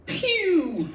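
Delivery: high mock gunshot pew; squeaky cartoon gun noise; gun sound. Improv player fires a silly high pew. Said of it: "What the fuck was that?".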